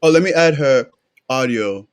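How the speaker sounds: usual speaking voice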